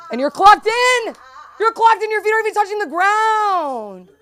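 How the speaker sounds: high, loud laughing rant; mock outraged yell. At work, teasing the new guy, a high laughing mock shout. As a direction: shriek it in disbelief, laughing. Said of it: "I'm like".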